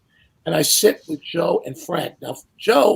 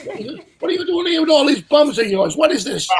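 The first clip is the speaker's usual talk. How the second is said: fast, higher, laughing through it; laughing retort; 8 semitones higher, faster than usual. Retold or imitated. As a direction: fire it back while laughing. Teasing reply to Steinbrenner.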